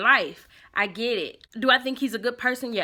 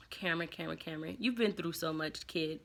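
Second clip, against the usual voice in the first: much softer and lower, sighing sympathy; sad pitying sigh; 5 semitones lower, 13 dB quieter than usual. Real, not acted. Pitying aside to Cameron.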